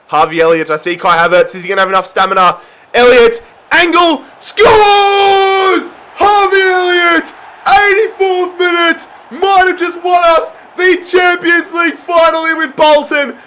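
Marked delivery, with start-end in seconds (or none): 2.9-13.4 winning goal scream. Real, not acted. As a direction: scream the goal like the trophy depends on it. Winning goal celebration commentary.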